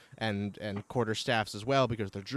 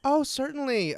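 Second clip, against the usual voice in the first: higher, louder acted woman's voice; obliging druid woman; 13 semitones higher, 5 dB louder than usual. Druid woman agreeing to give water, high obliging voice. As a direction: pitch it up as an eager-to-help woman.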